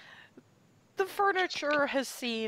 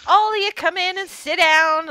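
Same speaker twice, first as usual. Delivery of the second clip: loud invitation; 9 semitones higher, 10 dB louder than usual. Acted. Lisa ushers the group.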